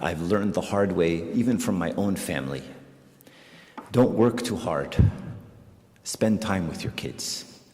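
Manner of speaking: ordinary talk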